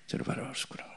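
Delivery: soft amused whisper; whispered pity. Retold or imitated. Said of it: "이러면서". (귀엽다는 듯 속삭이는 말투)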